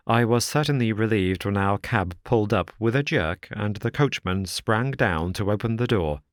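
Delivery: ordinary delivery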